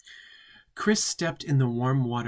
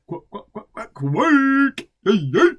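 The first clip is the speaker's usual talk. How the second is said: mock stuttered quake; 10 semitones higher, 9 dB louder than usual. Reading Chris's orgasm as a stammering, exploding quake. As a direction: stammer then explode on the word.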